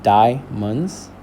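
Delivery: "diamonds" slowly broken into stressed "di" and unstressed "monds"; stress on first syllable. Shown as a demonstration. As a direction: lean on the "di" of "diamonds", lighter on "monds". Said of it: "Di is louder and a little bit more drawn out".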